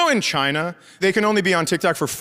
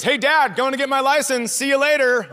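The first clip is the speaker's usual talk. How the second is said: breezy daughter; 7 semitones higher than usual. Retold or imitated. Retold daughter leaving, full of confidence.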